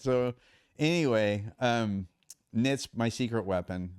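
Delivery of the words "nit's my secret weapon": ordinary talk, nothing marked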